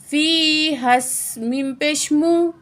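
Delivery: syllables drawn out and joined; teacher modelling tajweed; slow recitation. Shown as a demonstration. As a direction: chant the joined word slowly.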